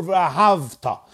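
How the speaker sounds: v'ahavta with V straight into T; no vowel between V and T in v'ahavta; silent shva in v'ahavta. Shown as a demonstration. Teacher offering two readings, silent shva in v'ahavta. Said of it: "V'ahavta is a shvanach".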